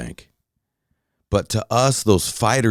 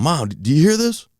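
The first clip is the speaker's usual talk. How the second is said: high-pitched call to mom, only mildly louder; retold raised voice; 8 semitones higher than usual. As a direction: retell talking over a loud noise to mom. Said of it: "had to start talking louder".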